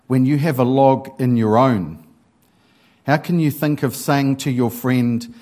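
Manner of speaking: emphatic, projected Bible reading; scripture reading. Acted. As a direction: read scripture out firmly to the congregation. Reads the Bible passage aloud.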